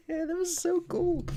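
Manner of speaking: high, slow squeal of delight; freaking out. Real, not acted. Streamer after the trailer ends, freaking out. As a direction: squeal it, stretched out and giddy. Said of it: "I'm freaking out".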